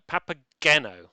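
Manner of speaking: Papageno with a hard G, like gorilla. Shown as a demonstration. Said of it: "Hard G is like gorilla".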